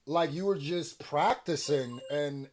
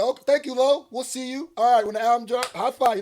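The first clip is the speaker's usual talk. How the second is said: high, loud mock label voice; mock label exec; 8 semitones higher, 9 dB louder, faster than usual. Mocking the label sending [name] off, high and loud. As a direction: imitate a cheesy label exec thanking him. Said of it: "They said".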